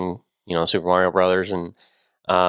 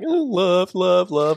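higher, gushing ad voice; mock romantic gushing; 9 semitones higher, much slower than usual. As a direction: gush like a sappy ad. Mocking Valentine's ads.

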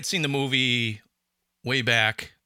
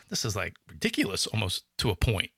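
lower, softer weary sigh; exasperated; 5 semitones lower, 5 dB quieter than usual. Retold or imitated. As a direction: sigh it out, fed up. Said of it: "But now it's like".

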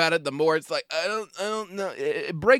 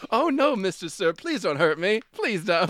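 higher pleading English accent, hammed-up; mock English accent; 5 semitones higher than usual. Teasing co-host as nervous gas station attendant, mock English pleading. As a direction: beg like a hammy nervous English actor. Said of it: "Why do you have an English accent?".

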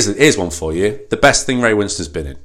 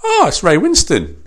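higher, bright mock surprise at spotting him; mock delighted recognition; 10 semitones higher than usual. Cinema viewer spotting the villain, mock delighted. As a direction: fake thrill at seeing Ray on screen. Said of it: "Oh, gutted".

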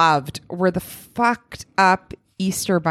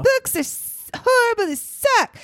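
high mock whine. Mock whiny complaint about books.

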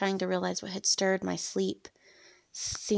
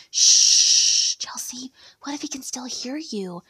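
loud shush, then higher, hushed words; urgent hushing; 5 semitones higher, 7 dB louder, slower than usual. A narrator's reading. Woman calming her lustful friend in a cafe, hushing. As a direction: shush a friend who might be overheard. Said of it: "trying to calm her a little".

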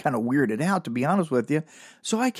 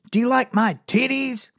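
higher, put-on voice of a brash woman; lady's voice; 10 semitones higher than usual. Comic retelling of a street lady asking, higher brash voice. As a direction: ham up the pushy lady. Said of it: "she goes".

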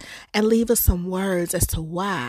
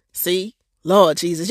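normal talking voice

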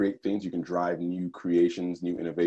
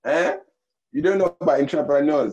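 Nigerian accent; 10 semitones higher, 6 dB louder than usual. Shown as a demonstration. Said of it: "That's my Nigerian accent".

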